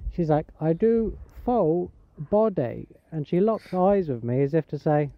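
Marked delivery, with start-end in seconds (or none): 0.6-2.9 very slow put-on Thai lady accent. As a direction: repeat the offer slowly in imitated Thai accent. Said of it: "was a Thai lady".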